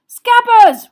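shouted. Acted. Ron shouting after his escaping rat, high and loud. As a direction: yell after the fleeing rat. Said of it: "Ron shouted".

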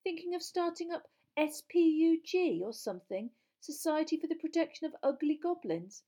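loud, high-pitched mockery; teasing. A narrator's reading. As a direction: jeer at the idea with gusto. Ron teases Hermione about goblins.

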